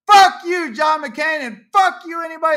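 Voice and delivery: loud, high shouted curse; furious shout. Real, not acted. Ranting at John McCain's photo, shouting curses in real fury. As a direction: spit it out at full volume.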